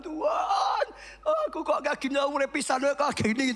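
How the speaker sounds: mock tearful wail to God; crying prayer. Pastor acts out a weeping prayer, lightly mock crying. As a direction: imitate someone crying out to God. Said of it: "doamu sambil nangis".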